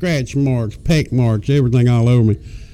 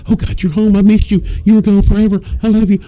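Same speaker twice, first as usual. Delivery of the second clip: overjoyed dog; 8 semitones higher, faster than usual. Retold or imitated. Dog greeting owner at the door, high ecstatic gush. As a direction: burst out like a dog thrilled to see you. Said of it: "that dog's".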